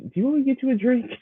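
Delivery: playful mock offer. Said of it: "as I'm like".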